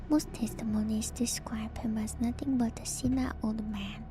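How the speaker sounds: ordinary talk, nothing marked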